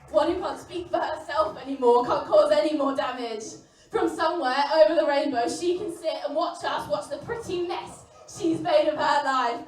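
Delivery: put-on bitter loud outcry to the boys about a dead woman. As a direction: hurl it out at full volume. Bitter outburst at the men.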